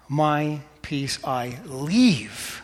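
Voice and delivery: very slow, leave stretched out with a swell in pitch; measured phrase, long swooping leave; stress on leave. Shown as a demonstration. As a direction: draw out the word leave. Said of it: "Emphasize one word".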